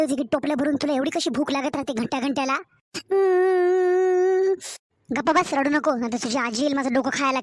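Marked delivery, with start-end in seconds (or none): 2.9-4.5 long whimpering sob; a drawn-out crying hum. Acted. Scolded girl whimpering to aunt, a long crying hum.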